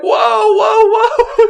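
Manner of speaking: very high, loud yelps of alarm; retold yelling. Hunters backing away from an armed landowner, yelling in alarm. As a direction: yell it while breaking into laughter. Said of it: "We're yelling".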